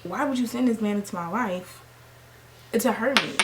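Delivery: rapid, run-together venting; fast rant. Retold or imitated. Crying woman waving off her coworker, fast rant. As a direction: tumble out the words. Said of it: "I'm like".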